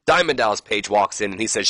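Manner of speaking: ordinary delivery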